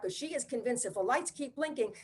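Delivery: ordinary talk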